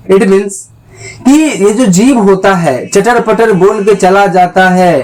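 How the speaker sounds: ordinary delivery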